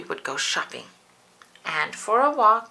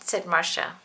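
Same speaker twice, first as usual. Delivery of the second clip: usual speaking voice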